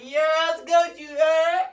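high, loud shout to someone across the street; rowdy calling out; hyped holler. Retold or imitated. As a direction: yell it out with reckless hype.

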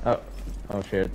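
usual speaking voice

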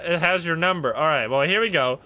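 ordinary talk

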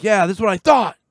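high, loud smug comeback; mock smug retort. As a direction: gloat like you just won the standoff. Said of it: "and just be like".

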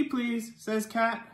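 ordinary delivery

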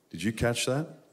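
ordinary talk, nothing marked

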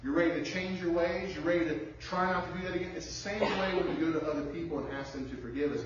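ordinary talk, nothing marked

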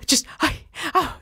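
gasp, then a breathless high fanboy gush; starstruck kid. Retold or imitated. Young kid meets the badass swordsman, high starstruck gush. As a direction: swoon like a kid meeting his hero. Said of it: "It's like Michael Jordan".

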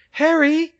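very high, loud cry of a name; high-pitched panicked shout; alarmed call. A narrator's reading. Calling out to Harry in alarm.

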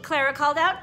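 lively storybook read-aloud with character voices; reading to children. A narrator's reading. Teacher reading a picture book to her class, animated. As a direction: read it like story time for little kids.

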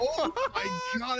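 high, slow laughing panic; panicking gamer. Real, not acted. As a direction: squeal in panicked disbelief. Panicking while losing round.

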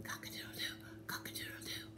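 hushed, voiceless cock-a-doodle-doo; whispered rooster crow. Acted. Rooster who lost his voice tries to wake the farmer, whispering. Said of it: "he's still whispering".

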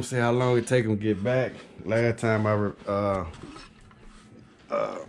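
ordinary talk, nothing marked

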